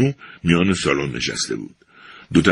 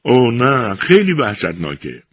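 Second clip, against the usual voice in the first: higher, louder horrified cry; higher pitched dismayed cry; 6 semitones higher, 3 dB louder than usual. A narrator's reading. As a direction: recoil in horror. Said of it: "فرانکی بیچاره فریاد زد".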